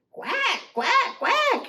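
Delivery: high, repeated quacks; alarmed duck quack. Acted. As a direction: quack in a panic.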